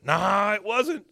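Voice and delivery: high, loud scoffing nah; mock Buck denial. Buck scoffing at the sheriff, high and loud. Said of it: "He goes".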